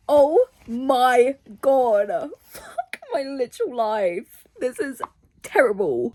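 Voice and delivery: slow, drawn-out groan of dismay; despairing exasperation. Real, not acted. Reader regretting trusting a recommendation, exasperated. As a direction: draw every word out in dismay.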